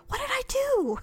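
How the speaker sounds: high, stunned squeak of regret; mock morning-after panic. Friend mimics waking after drinking, high mock panic. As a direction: squeal it like waking up to a bad memory. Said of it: "You wake up in the morning and go".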